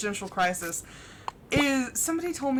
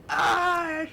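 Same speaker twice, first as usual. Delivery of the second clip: sharp loud "Ah!" of neck pain; mock yelp as the neck seizes; pained neck groan; 7 semitones higher, 5 dB louder than usual. Feeling her stressed neck, mock pained yelp. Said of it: "I'm just like".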